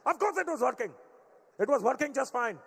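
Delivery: high loud defensive Indian-dad reply; indignant dad. Retold or imitated. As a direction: defend the indicator, offended.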